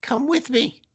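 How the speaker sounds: high squeaky mock pleading. Creep begging Faith to leave with him, squeaky. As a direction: whine it way up high like a desperate creep. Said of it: "and is like".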